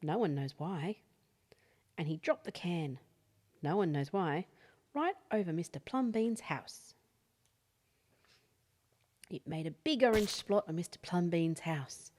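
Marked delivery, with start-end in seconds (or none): none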